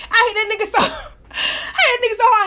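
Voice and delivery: high-pitched, breaking up with laughter; laughing. Real, not acted. Woman recalling hitting her sneaky dog, laughing. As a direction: struggle to tell it through laughter.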